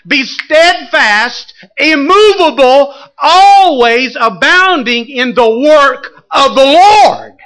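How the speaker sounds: very slow, high, loud preaching; slow, loud, high-pitched scripture proclamation; preached at full force. Shown as a demonstration. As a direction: thunder out the verse. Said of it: "Watch this, watch this".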